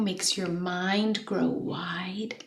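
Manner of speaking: slow, drawn-out whisper. Acted. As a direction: let the line fade into a slow whisper. Whispered slow poem ending.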